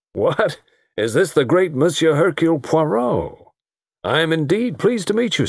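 high-pitched, effusive greeting; hearty American host. Acted. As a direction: greet a celebrity with great show.

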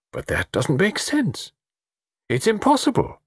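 baffled, high-voiced objection; incredulous protest. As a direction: protest as the pitch climbs. Satterthwaite protesting a startling idea.

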